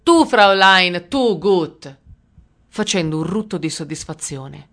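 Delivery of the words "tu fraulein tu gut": slow, loud, thick-voiced approval; gurgling voice. A narrator's reading. Russian soldier choosing a young woman, gurgling voice. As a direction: gurgle it with crude relish. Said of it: "Disse con voce gorgogliante".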